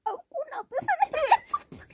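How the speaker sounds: high laughing protest; laughing complaint that no money came. Real, not acted. Complaining about tooth fairy.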